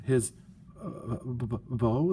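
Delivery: caught-out stammer on bow; stammered in surprise. Acted. Sisters caught off guard stammer at the question, halting. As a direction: stumble over the word like you're caught. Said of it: "they stammered in surprise".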